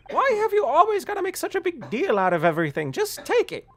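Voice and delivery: put-on nagging old woman voice; old lady voice. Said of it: "old lady voice".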